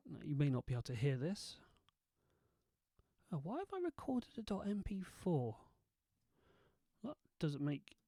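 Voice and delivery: normal talking voice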